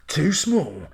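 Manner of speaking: mock trailer-announcer delivery; everyday remark as a dramatic film voiceover; trailer voiceover guy. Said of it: "is that how he's doing it?".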